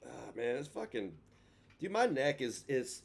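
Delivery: ordinary delivery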